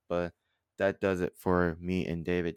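ordinary talk, nothing marked

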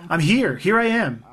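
mild imitated yell; retold yell. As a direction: call out as if from afar, not at full volume. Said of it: "and he yelled".

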